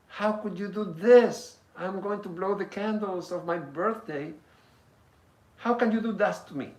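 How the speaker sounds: put-on high, loud, outraged child's cry; upset little girl. Daughter protesting to her father who blew out her candles, high tearful outcry. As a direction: wail it like a hurt six-year-old. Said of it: "She is so shocked and sad and mad".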